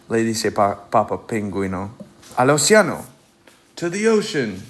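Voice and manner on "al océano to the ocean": eager call. A narrator's reading. Papa calling family to ocean.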